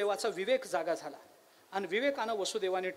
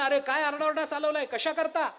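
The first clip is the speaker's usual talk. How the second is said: higher-pitched mock rebuke; mock scolding; 6 semitones higher, 3 dB louder than usual. Sky questioning the cloud about its racket, mock scolding. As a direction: lightly scold a noisy troublemaker.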